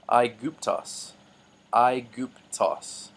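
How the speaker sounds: "Aiguptos." said slowly, eye-goop-tos; slow careful pronunciation. Shown as a demonstration. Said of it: "I'll pronounce these words in the middle".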